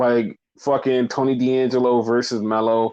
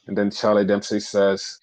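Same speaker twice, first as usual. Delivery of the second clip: normal talking voice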